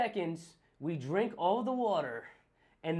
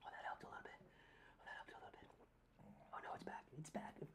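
hushed relief turning to dismay; whispering through spicy pain; 20 dB quieter, faster than usual. Real, not acted. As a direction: whisper it, then despair.

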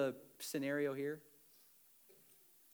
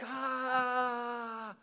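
mock groan; 11 semitones higher, 6 dB louder than usual. Sunday morning groan.

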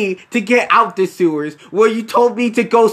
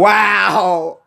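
mock shout; 4 dB louder than usual. Mock shock at heart.

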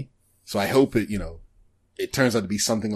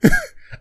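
sudden high giggle; high burst of laughter; 5 dB louder than usual. Real, not acted. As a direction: laugh shooting up into a high squeal. Still laughing about the synopsis.

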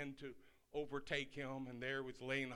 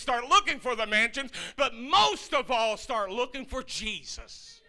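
loud, high, building sermon cry; hammered-out pulpit preaching; full-force preaching; 6 semitones higher, 11 dB louder than usual. Acted. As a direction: preach it hard to the congregation.

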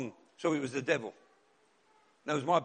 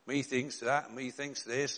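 lightly voiced quaint old preacher; a hint of old Gloucestershire speech; imitating old-fashioned preacher; 6 semitones lower, 4 dB quieter, slower than usual. Mimicking the weird preacher from Gloucestershire, low and quiet. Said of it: "spoke like this all the time".

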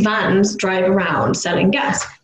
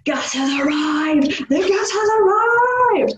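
much higher, slow loudspeaker scream; screaming announcement; 10 semitones higher, slower than usual. Retold or imitated. Imitating the Gasco van ending its song, a high slow scream. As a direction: belt it out like the van speaker. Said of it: "it just starts screaming like".

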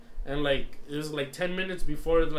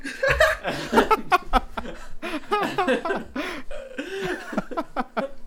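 long, high-pitched cracking-up laugh; laughing fit; 12 semitones higher than usual. Real, not acted. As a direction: burst out laughing and keep it going. Cracking up over own embarrassing story.

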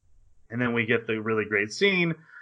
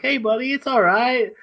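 friendly knights; 11 semitones higher, 5 dB louder than usual. Retold or imitated. Knights comforting a beaten friend.

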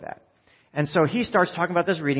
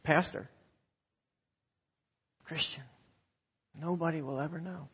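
hushed tempting thought; 12 dB quieter than usual. Retold or imitated. Temptation voiced to the congregation.

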